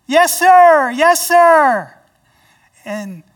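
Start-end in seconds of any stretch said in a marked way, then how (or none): 0.1-1.9 loud, high Southern yes sir; cheerful booming agreement; hearty yes sir. Retold or imitated. Imitating Brian's greeting.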